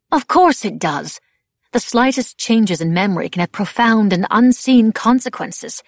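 loud, emphatic insistence; Madame Labelle, vehement. A narrator's reading. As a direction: insist forcefully, as settled fact. Vehement answer in the lecture.

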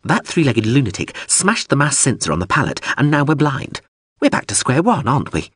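high, insistent drone voice; pushy drone. Acted. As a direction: nag him like a fussy machine. Drone complains, rising.

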